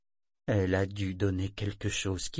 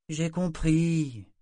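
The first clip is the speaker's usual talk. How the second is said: sullen, drawn-out, higher-pitched reply; marmonnement maussade; 7 semitones higher, 3 dB louder, much slower than usual. A narrator's reading. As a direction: drag it out grudgingly. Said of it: "marmotta-t-elle". (réponse boudeuse et traînante)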